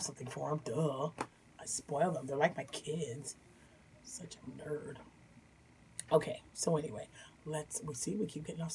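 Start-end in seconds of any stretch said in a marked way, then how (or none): 4.0-5.0 soft fond whisper; whispered aside. Real, not acted. Vlogger whispers a fond aside about her spoiled dogs. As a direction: breathe it out like a private joke.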